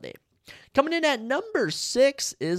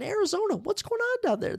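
much higher, incredulous calling out; mock scold. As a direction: call out Arizona in disbelief.

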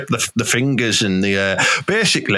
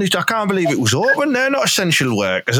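usual speaking voice

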